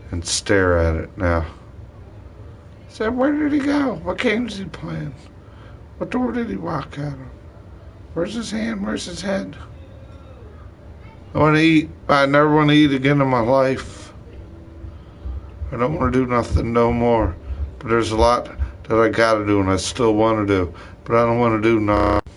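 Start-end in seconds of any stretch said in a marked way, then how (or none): 2.9-9.6 much higher, bewildered asking; searching and puzzled, pitched up; high puzzled questions. Real, not acted. Wondering where he went, much higher bewildered. 11.3-22.2 louder, exasperated declaring; frustrated outburst. Real, not acted. Fed up with everything, louder exasperated.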